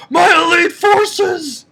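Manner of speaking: high, loud, drawn-out mock cry; mock dramatic exclamation. Reader mocking the villain's line to fans, hammed-up high cry. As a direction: blow it up into a hammy battle cry.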